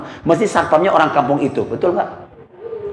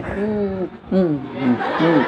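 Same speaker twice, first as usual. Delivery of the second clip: mischievous humming; 2 semitones lower, 6 dB quieter, much slower than usual. Retold or imitated. Kids humming mischievously in prayer.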